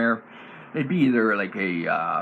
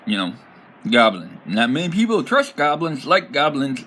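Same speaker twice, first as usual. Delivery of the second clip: normal talking voice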